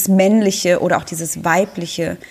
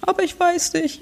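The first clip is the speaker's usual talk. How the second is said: put-on inner child; 10 semitones higher than usual. Inner child answers comforting self.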